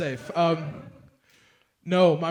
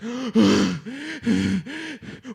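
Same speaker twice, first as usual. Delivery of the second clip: dramatic gasp; 6 semitones higher, 3 dB louder than usual. Retold or imitated. Dad bursts in, panicked.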